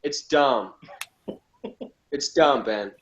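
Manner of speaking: ordinary delivery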